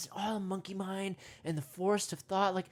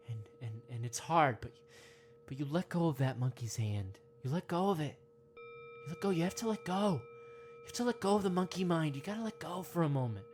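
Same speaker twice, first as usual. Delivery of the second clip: guided meditation; 5 semitones lower than usual. Acted. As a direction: ease the listener in gently, mock-serene.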